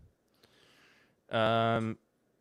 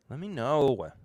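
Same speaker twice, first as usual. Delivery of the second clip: put-on whiny little kid asking for attention; annoying kid voice, needy and whiny; 3 semitones higher, slower than usual. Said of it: "Just an annoying kid voice".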